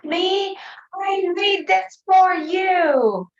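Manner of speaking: put-on a little girl yelling for attention; child yelling. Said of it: "she yells at me".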